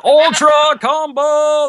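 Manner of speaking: booming drawn-out announcer call; fighting game announcer. Retold or imitated. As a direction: hype it up like an arena announcer. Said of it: "that voice, that was him".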